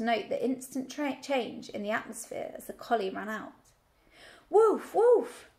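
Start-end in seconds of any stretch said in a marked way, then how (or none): 4.5-5.5 high, loud bleating woof; the flock mocking the dog with a high bleat; sheep crying woof. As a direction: bleat the alarm like frightened sheep. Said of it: "cried the flock".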